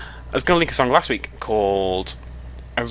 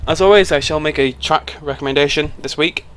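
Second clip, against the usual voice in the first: ordinary delivery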